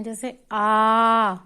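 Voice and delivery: आ drawn out long, mouth wide and pushed; long forceful aa. Shown as a demonstration. Teacher showing how to say आ with force. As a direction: open wide and press out a long आ. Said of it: "थोड़ा pressure लगाना पड़ता है".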